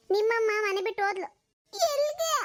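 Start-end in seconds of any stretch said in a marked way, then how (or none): 1.7-2.3 put-on high little boy voice asking why; a small boy pleading to know why; child's plaintive question. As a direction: ask like a bewildered small child. Child asks where.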